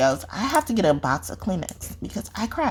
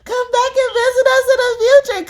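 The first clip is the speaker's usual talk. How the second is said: squeaky sign-off; 6 dB louder than usual. Acted. High sign-off voice.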